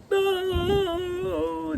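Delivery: long, very high crying wail; child's howl. A narrator's reading. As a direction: wail like a spoilt little girl. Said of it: "Lottie howled tempestuously".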